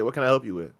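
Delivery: low, soft, even clerk's reply; calm service voice. Shown as a demonstration. Store clerk calmly answers a rude customer, soft and even.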